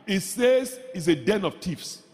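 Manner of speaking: usual speaking voice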